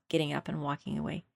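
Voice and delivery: usual speaking voice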